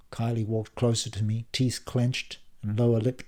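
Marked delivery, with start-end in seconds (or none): none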